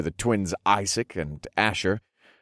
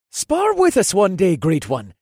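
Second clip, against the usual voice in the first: eager, higher and louder request; insistent; 12 semitones higher, 5 dB louder than usual. A narrator's reading. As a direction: press the great man for a bout. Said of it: "Isaac insisted".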